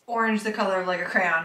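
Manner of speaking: ordinary delivery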